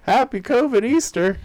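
sing-song high holiday wish; high-pitched mock greeting. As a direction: jump way up high.

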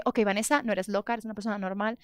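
soft muttered pep talk to herself; quietly calm yourself down; self-talk. Retold or imitated. Woman outside his door, quietly talking herself down. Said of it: "Yo dije".

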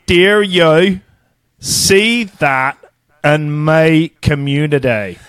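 slow, deliberate Northern Irish accent; exaggerated Northern Irish reading, phrase by phrase; phonetic Belfast accent. Shown as a demonstration. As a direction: spell it out slowly in broad Belfast. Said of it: "I'll read it phonetically".

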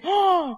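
high, drawn-out "ah" of wonder; imitated amazed gasp. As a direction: gasp in mock astonishment. Compañera asombrada de sus dedos. (grito ahogado de asombro fingido)

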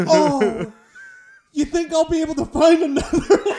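high, loud mocking laugh through the words; mock the customer while laughing; sarcastic laughing retort. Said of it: "He's like".

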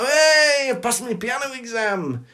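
high-pitched mock jubilation; delighted cheer. Hulk celebrating a piano exam, high and overjoyed. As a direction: whoop it with childlike pride. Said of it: "he's had a lovely day".

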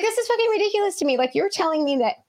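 high-pitched incredulous rant; retold outrage. Repeating her outburst after friend cuts in, high-pitched retold outrage. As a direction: relive the outburst with pitch shooting up. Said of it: "I was just so mad".

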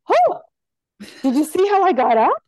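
excited, high-pitched imitation of a Haitian woman; Haitian accent. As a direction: imitate a delighted patient with a light Haitian accent. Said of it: "she says all this with a Haitian accent".

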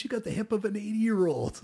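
high, loud impersonation of an excited doctor. Retold or imitated. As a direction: marvel at it in a high-pitched voice. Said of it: "he's, like, excited about it".

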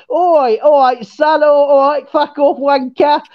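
hammed-up Cockney taunting; mock Cockney. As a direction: imitate a bad Cockney Londoner accent. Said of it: "like a, a Cockney Londoner".

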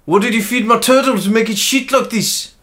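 high-pitched South African accent. Shown as a demonstration. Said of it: "South African".

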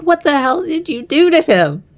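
high-pitched mock outrage; retold indignant demand, pitched well up; imitating pissed off. Podcaster voices Remy confronting whoever got his friend drunk, mock angry. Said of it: "Remy's pissed, 'cause he's like".

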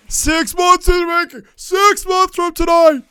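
high, loud parody of a promo line; hammed-up high-pitched promo yell; mocking shout. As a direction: shout it back in exaggerated mockery.

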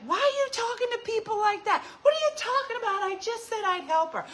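mom's scolding. Retold or imitated. Mom scolding dad for rudeness to guests, high-pitched exasperation. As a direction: squeak it out like an outraged mom.

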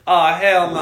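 loud, high outburst with a laugh; alarmed refusal. Retold or imitated. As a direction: blurt it out in comic alarm. Retold alarm at dog's stare.

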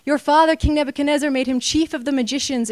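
the queen proclaiming Daniel's praise; a queen urging the king to call Daniel, proclaiming loudly. Retold or imitated.

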